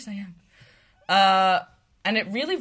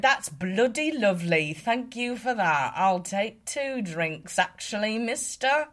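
slow put-on British banter; British accent; 3 semitones higher than usual. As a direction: draw it out in a playful British accent. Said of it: "doing my little British accent".

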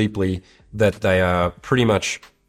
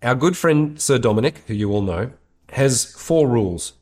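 ordinary delivery

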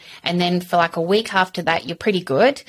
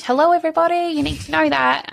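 calling out to everyone; 10 semitones higher than usual. Real, not acted. Mum on a podcast calls out to every listener, high and emphatic. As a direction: wake up the whole audience.

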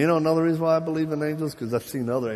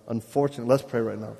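normal talking voice

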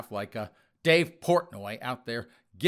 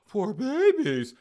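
high, slow, drawn-out mock sympathy; mock pity; 9 semitones higher, 6 dB louder, slower than usual. Host mocking offended magazine readers with sarcastic pity. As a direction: coo it like pitying a fussy child.

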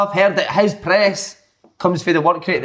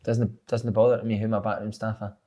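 imitating the manager; 7 semitones lower, 8 dB quieter than usual. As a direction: lightly voice a calm confident boss. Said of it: "and he's like".

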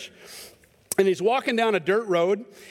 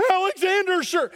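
high, tense soldier's reply; a caught sentry answering in fear; a hint of a terrified soldier; scared guard; 10 semitones higher than usual. Frightened guard answers general.

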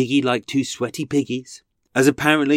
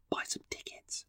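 unvoiced conspiratorial whisper; sneaky sales whisper; whispered aside; 22 dB quieter than usual. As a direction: slip the plug in under your breath. Aside plugging his walk.

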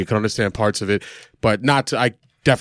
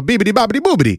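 mock Italian gibberish; 10 semitones higher, faster than usual. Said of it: "He goes to the deli".

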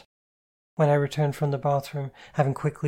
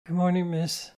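higher, sleepy polite mumble; mumbled politeness; 5 semitones higher than usual. A narrator's reading. Sleepy Kitty answers her miss, politely mumbled. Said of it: "adorable in her mumbled politeness".

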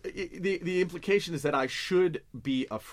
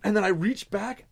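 usual speaking voice

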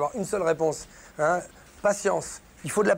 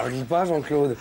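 ordinary talk

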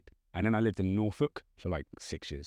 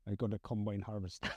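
hammed-up Norfolk farmer accent, softer; farmery Norfolk accent; 6 dB quieter than usual. Showing a friend how Norfolk sounds, mock farmery Norfolk accent. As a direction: put on a rustic Norfolk accent. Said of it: "it's a lot more farmery".

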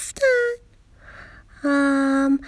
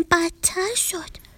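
whisper; 4 semitones higher, 6 dB quieter, much slower than usual. Acted. Admitting explaining made it worse.

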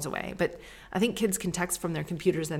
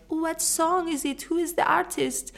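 much higher, slow try-hard mom voice; mocking herself; 12 semitones higher than usual. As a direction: parody your own eager asking. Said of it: "wants to seem down with the kids".

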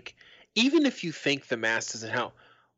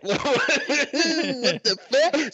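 much higher, laughing it out; real laugh breaking through the words; laughing disbelief; 12 semitones higher, 4 dB louder, much slower than usual. Real, not acted. Host cracking up at the showdown, laughing.

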